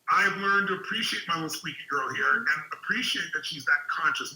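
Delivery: ordinary talk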